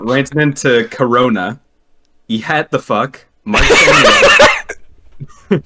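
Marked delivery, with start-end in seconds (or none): 2.3-5.6 reading a message while cracking up; real laughter through the words; laughing through it. Real, not acted. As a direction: stretch it out, laughing, high and loud.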